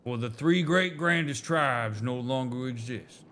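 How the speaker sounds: light Southern drawl for a storytelling elder; a hint of a Southern drawl, proud and nostalgic. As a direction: read it like a Southern elder recalling old glories. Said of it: "the southern molasses coming out of their mouths".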